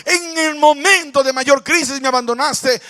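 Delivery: anguished complaint. Acted. Voiced complaint to God.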